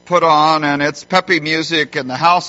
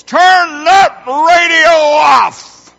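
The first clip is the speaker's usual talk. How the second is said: put-on high, loud bellow at the kids; angry dad shouting orders; yelling; 12 semitones higher, 8 dB louder than usual. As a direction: holler it across the house, dragging the words out. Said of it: "I yell out at the girls".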